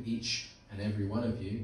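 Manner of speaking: ordinary talk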